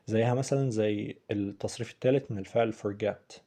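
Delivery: usual speaking voice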